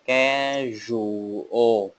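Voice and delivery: schedule drawn out slowly, each sound clear; slow model word. Shown as a demonstration. Teacher modelling schedule slowly for students. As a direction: stretch out schedule for the class to copy. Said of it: "đọc chuẩn là schedule".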